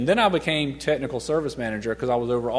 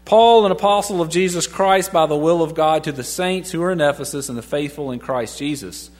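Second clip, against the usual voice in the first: loud, full-voiced Bible reading; proclaiming the verse; scripture reading; 4 dB louder than usual. Shown as a demonstration. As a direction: read it out boldly to the room. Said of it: "verse one".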